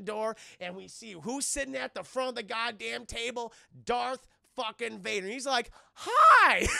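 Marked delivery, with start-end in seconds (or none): 5.9-6.7 higher, loud cartoon Hi; mock cheery greeting. Vader surprising the heroes at dinner, loud mock hello. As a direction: pop out a silly bright hello.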